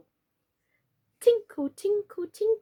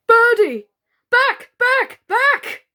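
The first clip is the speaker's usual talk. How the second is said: very high, loud frantic shout; cried out; 12 semitones higher, 9 dB louder, slower than usual. Acted. Mister Plantagenet shouting Birdie away from the lamp, frantic. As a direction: shout her back in terror. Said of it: "cried Mister Plantagenet".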